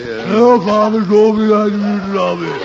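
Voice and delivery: booming theatrical mimicry of his son; hammy stage actor. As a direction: overplay it like an old ham actor. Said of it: "teach him how to talk like an actor".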